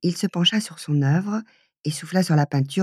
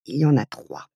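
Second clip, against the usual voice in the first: disgusted; 4 semitones lower than usual. A narrator's reading. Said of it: "dit d'un ton dégoûté".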